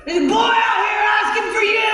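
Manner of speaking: dad shouting to his daughter; calling out. Acted. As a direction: yell it to the back room. Father calls to daughter.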